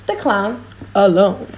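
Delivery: ordinary delivery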